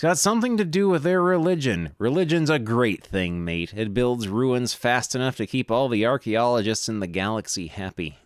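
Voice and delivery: lightly hammed Australian accent; Australian adventurer voice. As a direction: play the greasy Aussie digger, mate. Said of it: "I can't keep doing that voice".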